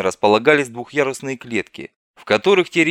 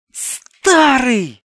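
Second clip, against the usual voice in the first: high, loud delighted shriek; joyful squeal; 13 semitones higher, 8 dB louder than usual. Acted. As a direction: squeal his name with relief. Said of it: "радостно взвизгнула девушка". (радостный визг)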